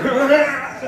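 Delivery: high, mock vampire screech; vampire snarl. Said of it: "they go into ambit vampire mode".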